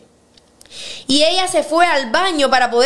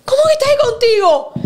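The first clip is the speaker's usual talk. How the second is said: high, loud, fast disbelieving outburst; the husband's shocked cry, imagined by the host; 10 semitones higher, 4 dB louder, faster than usual. Retold or imitated. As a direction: blurt it out high and stunned as the caught husband. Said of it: "Y él, ya me imagino".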